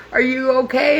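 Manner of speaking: high, worried check-in, laughing through tears; retelling how a coworker checks on her; concerned coworker. Retold or imitated. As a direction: voice the coworker's gentle worry. Coworker asks crying woman.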